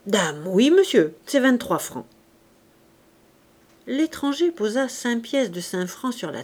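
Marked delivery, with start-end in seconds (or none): 0.1-2.1 confident, emphatic confirmation; firm self-assurance; assured. A narrator's reading. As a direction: answer without hesitation. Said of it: "Elle répondit avec assurance".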